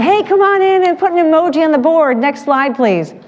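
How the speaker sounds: high, bright welcoming call; cheery welcome. Retold or imitated. Greeting gloomy kids at the door, high and cheery. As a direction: coax reluctant kids in with cheer.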